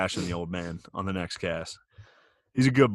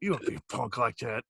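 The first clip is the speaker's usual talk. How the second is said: imitating angry dad; 7 semitones higher than usual. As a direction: ham up the dad squaring off in the garage. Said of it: "and he was like".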